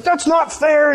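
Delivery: high-pitched mock complaint; hammed-up wronged protest; upset protest. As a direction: act out an upset worker, pitch jumping up. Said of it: "all upset".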